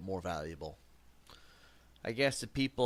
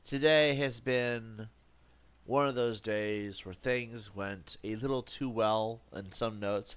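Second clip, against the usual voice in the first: ordinary talk, nothing marked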